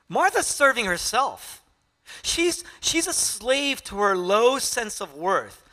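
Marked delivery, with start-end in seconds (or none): none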